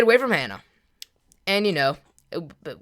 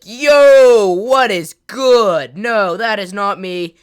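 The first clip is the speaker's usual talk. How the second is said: loud, high, drawn-out protest; outraged denial; 5 semitones higher, 14 dB louder, much slower than usual. Retold or imitated. Boy sees fake picture tagged with him, loud outraged denial. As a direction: shout it like you can't believe it. Denying the fake photo post.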